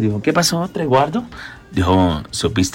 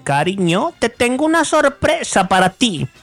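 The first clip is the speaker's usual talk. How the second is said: higher, coy wife in a joke; wife's voice; 6 semitones higher than usual. Acted. Wife telling husband of a surprise, coy higher voice. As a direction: tease the surprise sweetly as the wife. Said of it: "le dice la mujer al marido".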